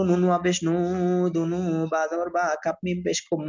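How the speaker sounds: ordinary talk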